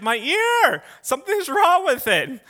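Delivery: high, loud mock whine of pain; hammed-up, high-pitched complaint; screeching little girl voice. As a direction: imitate a big man squealing in panic. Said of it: "with this screeching little girl yell".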